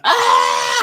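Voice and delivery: high-pitched comic scream; Sam Kinison. Retold or imitated. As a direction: let out a comedian's trademark yell. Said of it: "was the guy who went".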